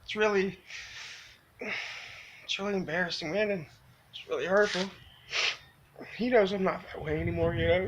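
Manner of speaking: choked up. Real, not acted. Confessing a painful mistake to viewers, choked up. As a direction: hold back tears while confessing. Tearful confession of hurting Michael.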